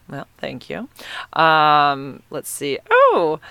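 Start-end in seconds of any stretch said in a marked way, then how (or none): 2.9-3.4 surprised oh. Real, not acted. Spotting a funny username.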